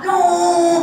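high, loud, stretched no; alarmed high cry of no; panicked refusal. Acted. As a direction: stop him before he screams. Man stops boy from screaming.

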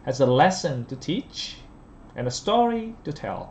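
slow, rising to "teach", dropping on "tell"; list intonation. Shown as a demonstration. Teacher showing list intonation, up on teach, down on tell. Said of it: "liệt kê ta lên giọng đúng không".

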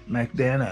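マクドナルド said the English way, vowels squeezed out; マクドナルド as a native "McDonald's", not katakana; English-style McDonald. Shown as a demonstration. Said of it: "一人だけマクドナルドっつったら".

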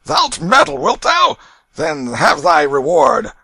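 high, very loud ruffian's roar; furious roar. A narrator's reading. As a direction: roar the threat with full menace. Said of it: "Canty roared out".

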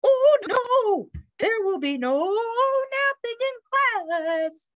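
high, slow operatic refusal; declaring bird teacher. A narrator's reading. Bird forbids the dog napping in class, high and emphatic. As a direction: declare it in a high, stretched sing-song. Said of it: "declared the bird".

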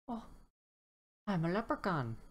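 a hint of a cheeky leprechaun. Leprechaun introduces himself to the dinosaur, lightly voiced.

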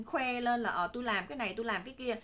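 usual speaking voice

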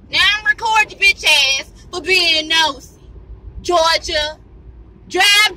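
high, loud yell at a nosy driver; furious outburst at the driver who keeps staring; angry yelling. Real, not acted. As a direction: cuss out the nosy driver behind you, full voice.

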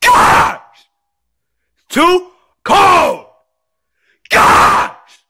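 high-pitched whining yell; screaming tantrum. Acted. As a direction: rant in a high voice, breaking into screams. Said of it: "Stop screaming!".

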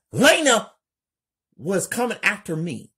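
very high, loud, fast panicked telling; scared. Acted. Kelly telling friends Lena chased her, panicked and fast. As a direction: blurt it out breathless with fear. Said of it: "Kelly scared".

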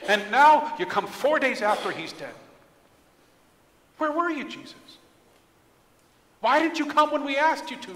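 hurt, indignant complaint; angry, frustrated reproach. Acted. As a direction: demand to know where he was. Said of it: "They were frustrated at Jesus".

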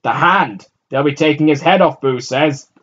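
loud, high answer over shoulder; shouting back. A narrator's reading. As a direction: shout back without slowing. Boy shouting back running.

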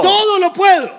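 very high, loud cry falling at the end; climactic echoed shout. Real, not acted. Interpreter matching the preacher's climax, triumphant shout. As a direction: belt out the triumph.

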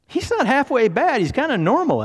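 very high, loud imitation; hammed-up squeaky surprise; pleasantly surprised. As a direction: squeal it in mock amazement. Acting out her surprise at date.

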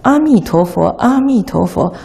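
阿弥陀佛 with a clear, open "a" on 阿; unhurried 阿弥陀佛 starting on "a"; open "a" pronunciation. Shown as a demonstration. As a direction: say 阿弥陀佛 singly, opening wide on "a". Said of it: "你单一你讲".

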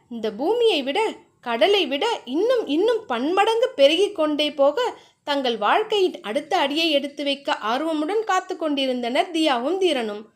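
ordinary delivery

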